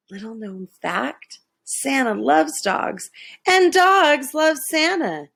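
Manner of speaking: ordinary delivery, nothing marked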